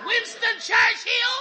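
high, slow, scornful yell; mother-in-law mocking her son-in-law with a shout; sarcastic shout. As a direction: fling the name back at him mockingly.

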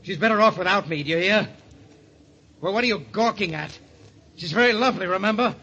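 high, heated challenge; agitated, defensive. Acted. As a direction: snap back wildly at a prying clerk. Guest lashes out at clerk.

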